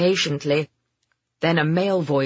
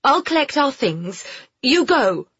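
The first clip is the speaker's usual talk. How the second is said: high woman's voice calling after him; raised, high call; 8 semitones higher, 3 dB louder than usual. A narrator's reading. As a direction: call it out urgently. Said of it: "Pamela called".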